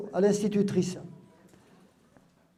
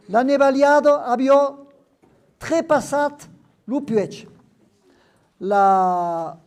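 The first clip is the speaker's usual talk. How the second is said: last paragraph read aloud, higher and louder; lecture en occitan; 5 semitones higher, 7 dB louder, slower than usual. Acted. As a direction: read the final Occitan passage carefully. Lit le dernier paragraphe. (lecture du dernier paragraphe en occitan)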